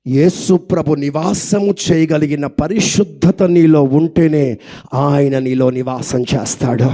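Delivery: low, slow, weighty pronouncement; solemn preaching. Real, not acted. Preacher telling the congregation about holiness, solemn and slow. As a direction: lay down each word slowly and deep.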